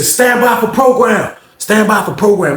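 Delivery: high, drawn-out call over the block; imitated announcement, pitched high; lady's announcement. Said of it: "Lady said".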